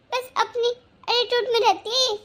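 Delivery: normal talking voice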